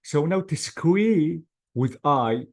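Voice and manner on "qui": "qui" as one isolated, drawn syllable; separate syllable. Shown as a demonstration. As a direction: sound out "qui" on its own. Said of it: "this is the first syllable".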